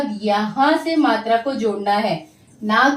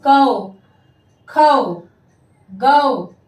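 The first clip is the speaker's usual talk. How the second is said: loud, isolated syllables with pauses; teacher modelling sounds emphatically; repeat-after-me drill; 6 dB louder, much slower than usual. Shown as a demonstration. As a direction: call each syllable out for pupils to echo. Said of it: "आप सब मेरे पीछे पीछे बोलिए".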